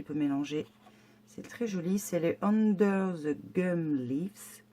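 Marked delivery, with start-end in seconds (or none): 2.4-4.6 slow, careful English with a French accent; French-accented English, slowly pronounced; self-mocked English accent. Real, not acted. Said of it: "avec mon super accent".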